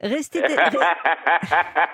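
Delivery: reading it out, cracking up with laughter; a laugh breaks through the reading; giggling over the message. Real, not acted. Reading listener's SMS, breaks up.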